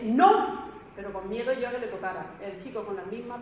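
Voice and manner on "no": a sharp, loud, higher "No"; retold shout. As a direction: snap out a loud no, afraid for the melon. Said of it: "y le dije".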